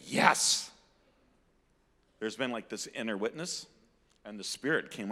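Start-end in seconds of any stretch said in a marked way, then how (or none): 0.0-0.7 loud, emphatic exclamation; heartfelt yes. Retold or imitated. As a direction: burst out with an inward yes. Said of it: "his heart has been saying".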